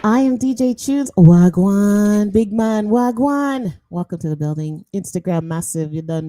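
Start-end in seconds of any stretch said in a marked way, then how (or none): 0.0-3.8 loud, Jamaican-style DJ hype; DJ hype intro. Acted. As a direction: call out like a radio DJ. DJ shout-out intro.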